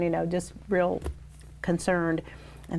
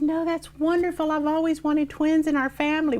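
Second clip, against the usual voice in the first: very high, gushing mother's voice; mother insisting twins are wonderful; mother delighted; 10 semitones higher, 3 dB louder than usual. Retold or imitated. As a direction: beam it like a proud grandmother. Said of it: "She said".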